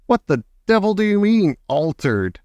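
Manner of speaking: outraged father, starting high and falling; mild indignant shout. A narrator's reading. Father demands an explanation from grandmother, mildly shouted. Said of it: "shouted Mister Jenkins".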